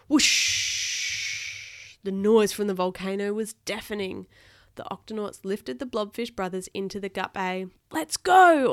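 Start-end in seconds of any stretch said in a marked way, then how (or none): 0.1-2.0 long, loud rushing whoosh; breathy eruption noise, fading away; imitated whoosh. A narrator's reading. 7.9-8.7 very loud, high command; captain's urgent holler; shouted order. A narrator's reading. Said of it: "ordered Barnacles".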